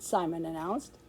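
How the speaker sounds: ordinary delivery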